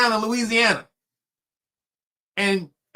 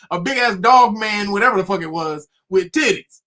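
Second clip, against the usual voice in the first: normal talking voice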